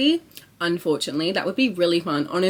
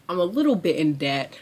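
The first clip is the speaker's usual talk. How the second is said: ordinary delivery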